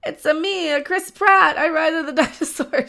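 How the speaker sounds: high, cartoonish Italian plumber voice; jokey Mario voice with a laugh; Mario impression. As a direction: play Chris Pratt as a goofy Mario. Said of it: "[name], you wanna do an Italian voice?".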